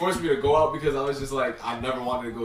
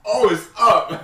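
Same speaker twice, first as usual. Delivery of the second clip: higher, louder, slow; mock reaction; 8 semitones higher, 8 dB louder, much slower than usual. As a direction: drag it out in a put-on voice. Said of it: "I'd be like".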